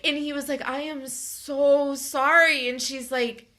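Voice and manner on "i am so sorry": slow, higher, drawn-out mock apology; retold mortified apology. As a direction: imitate someone apologizing profusely, stretching it out.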